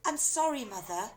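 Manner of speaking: high apologetic daughter. A narrator's reading. Daughter apologizing to her shouting mother. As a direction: say sorry quickly and nervously. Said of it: "Missus Cranky said".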